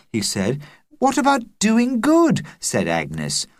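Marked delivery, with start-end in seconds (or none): 1.0-2.4 put-on much higher, prim girl voice; young girl asking, high; girl's voice. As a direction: play a good little girl. Agnes, a girl, asks.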